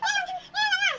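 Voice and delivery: high bursts of laughter through the words; laughing. Real, not acted. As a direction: laugh it out loud while telling. Laughing while retelling confusion. (natatawang malakas)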